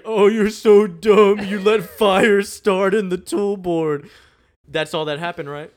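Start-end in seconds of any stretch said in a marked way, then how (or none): 0.0-4.1 high, loud, slow jeering bully voice, laughing through it; mocking bully taunt. Imitating bullies jeering at Cyrus, loud and mocking. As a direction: sneer it out like a schoolyard bully.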